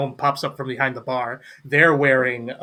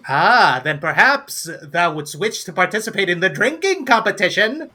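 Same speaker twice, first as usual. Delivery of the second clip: higher, theatrical invitation with rising swoops; showy gnome barkeep; 6 semitones higher than usual. Acted. Gnome inviting a drinker to compete, showy flourish. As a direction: lay out the offer grandly.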